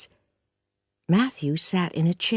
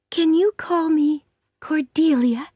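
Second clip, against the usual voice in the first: put-on hopeful pleading girl; 10 semitones higher than usual. Anne asking Marilla hopefully, girlish. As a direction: ask it with big hope.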